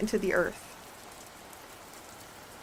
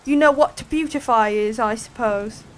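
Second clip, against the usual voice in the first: condescending; 8 dB louder than usual. Acted. Griffin lecturing Alice.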